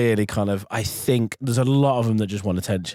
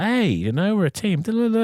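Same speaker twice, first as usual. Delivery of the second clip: higher-pitched, cheery mimicked team talk; upbeat team chatter; 10 semitones higher than usual. Teammates round the fire, mock upbeat chatter. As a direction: imitate a chummy team rallying round.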